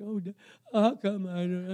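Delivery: mock whiny sobbing; boo-hoo cry. Shown as a demonstration. Preacher shows congregation what crying out isn't, mock boo-hoo cry. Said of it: "it's not a boo-hoo cry".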